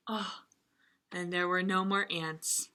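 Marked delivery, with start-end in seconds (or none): none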